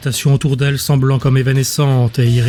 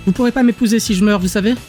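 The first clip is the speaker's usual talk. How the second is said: put-on higher, quick young heroine voice; protesting captive girl; 7 semitones higher, faster than usual. Captive warns Orvin she could die, protesting. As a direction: toss it out fast and high as a protest.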